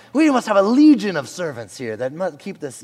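loud, high mock-king accent; botched, unplaceable accent for a Persian king; made-up royal accent. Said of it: "I don't know what accent this is".

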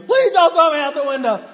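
high, loud mock scream of terror. Uncle faking being thrown out the window, hammed-up scream. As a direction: scream bloody murder as a pretend victim. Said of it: "So he's screaming".

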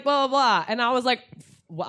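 ordinary delivery

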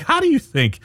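snarky retort. Retold or imitated. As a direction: throw the question back with scorn. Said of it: "I'm like".

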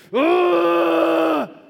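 high, loud mock yell of a frustrated kid; imitated toddler frustrated yell. Pastor imitating his son yelling from the other room, loud frustrated yell. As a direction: let out a sudden toddler scream of frustration. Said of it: "just goes".

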